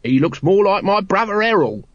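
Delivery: high, cheeky lovable urchin; chirpy city sparrow. A narrator's reading. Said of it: "playing the cheapy, chirpy, lovable city sparrow".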